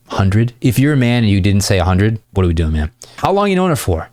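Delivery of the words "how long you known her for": high, lively curious question; high-pitched playful banter; playful follow-up question. Shown as a demonstration. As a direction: ask with bouncy, exaggerated interest. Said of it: "you're asking so many follow-up questions".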